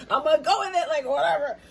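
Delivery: giggling whatever; giggly shrug. Pretend. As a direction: toss it off while laughing. Laughing quote.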